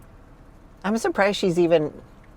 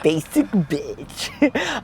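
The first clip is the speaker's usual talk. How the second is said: drawn-out echo; much slower than usual. Acted. Echoing friend's joke, laughing.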